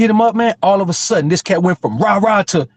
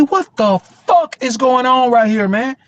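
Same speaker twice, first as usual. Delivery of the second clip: higher, slowed, baffled question; mock stunned slow question; slow disbelief; 7 semitones higher, slower than usual. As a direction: draw it out in disbelief. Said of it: "I said".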